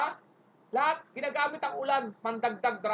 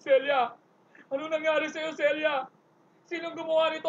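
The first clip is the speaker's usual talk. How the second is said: mild hammed-up soap grief; a touch of teleserye lament over Celia; soap opera hero; 4 semitones higher than usual. Hero finding Celia's body, lightly hammed soap grief. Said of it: "ganun sa teleserye".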